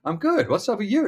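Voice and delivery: a dad playing along brightly; mock cheery reply. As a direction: answer the kid in a sing-song voice. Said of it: "And I was like".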